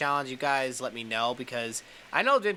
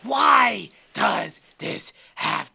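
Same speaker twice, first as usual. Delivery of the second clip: slow, loud hammed-up lament; mock anguished shout; 6 dB louder, slower than usual. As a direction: cry out slowly in mock despair. Frustrated over the game.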